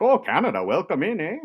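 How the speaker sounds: high, loud mock Canadian accent with eh; hammed-up Canadian accent, friendly and sing-song; mock Canadian welcome. Mocking officer's welcome to Canadians.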